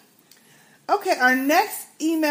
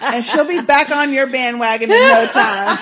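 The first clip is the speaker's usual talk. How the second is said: louder, breaking into big laughter; laughing hard; 2 semitones higher, 7 dB louder than usual. Real, not acted. Host joking to listener about crossbow, laughing loudly. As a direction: crack up at your own joke. Host cracks up at own joke.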